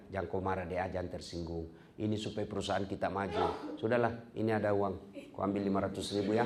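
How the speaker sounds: calm him down gently, slipping him money; soft coaxing boss. Acted. The director soothing her upset employee with a gift, soft coaxing voice.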